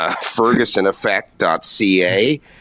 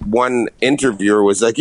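ordinary talk, nothing marked

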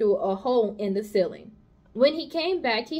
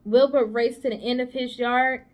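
ordinary delivery

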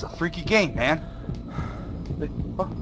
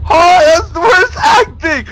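high, loud, drawn-out outcry; loud jeering; 10 dB louder, slower than usual. Real, not acted. Player heckling the game's voice acting, high jeering shout. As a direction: holler it in disbelief at awful acting.

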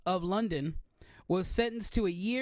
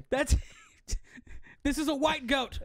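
ordinary talk

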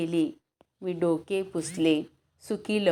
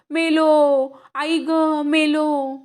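loud, higher, slow drawn-out cry; terrified scream; 9 semitones higher, 8 dB louder, slower than usual. Acted. Boy pushed into the well crying in terror, loud wail. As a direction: wail like you are drowning. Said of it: "मी ओरडलो".

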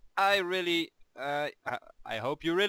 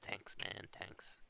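whispering; 7 semitones lower, 14 dB quieter, much slower than usual. Pretend. Sarcastic whispered thanks.

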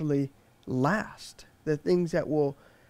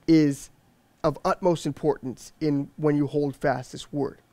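ordinary talk

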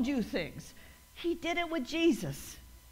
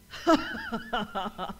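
scoffing laugh; 3 semitones lower than usual. Shown as a demonstration. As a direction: burst of dismissive laughter. Laughing off the devil's taunt.

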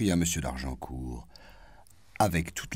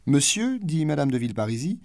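put-on high, louder old lady; marquise voice; 10 semitones higher, 5 dB louder than usual. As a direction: call him over politely. Hostess addressing Norpois.

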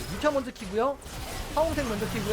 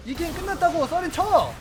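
exasperated call; 7 semitones higher, 5 dB louder than usual. Real, not acted. Urging enemies to surrender.

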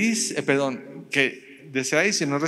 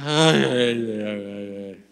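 mock yawn; 5 semitones lower, 5 dB louder, much slower than usual. Mock yawn sounds after prayer.

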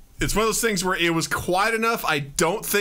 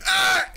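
startled yelp; 3 dB louder than usual. Retold or imitated. Muted, reacting to smell.

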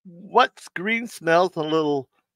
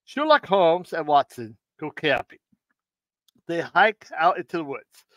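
ordinary delivery

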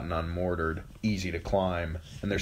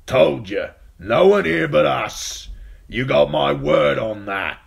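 put-on louder, slow old man's call; gruff old man; 8 semitones higher, 9 dB louder than usual. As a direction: answer the knight flatly, calling down. Yoren shouting down to knights.

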